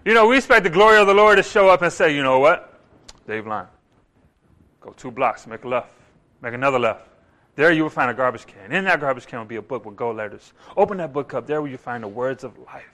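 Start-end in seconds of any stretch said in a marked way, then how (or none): 2.1-12.9 deadpan deep voice giving silly directions; mock voice of God. Preacher mocking how we expect God to speak.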